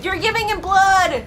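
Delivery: high-pitched cry of excitement; excited exclamation. Real, not acted. Viewer seeing blood given to Alucard, excited. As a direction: call it out, thrilled. Reacting to the fight.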